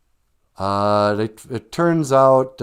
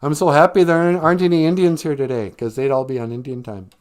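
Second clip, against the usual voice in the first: loud, high, smug school principal; mean principal; 8 semitones higher, 8 dB louder than usual. Retold or imitated. Principal greeting detention kids, smug and loud. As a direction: gloat over the kids like a pompous principal. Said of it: "the mean principal comes out, and he's like".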